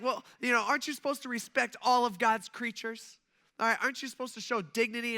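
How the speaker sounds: mock a scandalized woman's scolding; imitating an upset woman. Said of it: "she is just super, super upset".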